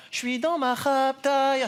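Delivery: high, slow, strange chanting prayer; mock prayer voice. Woman leading prayer in chapel, in a high drawn-out voice. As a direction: intone it like a pious church lady. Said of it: "elle était là".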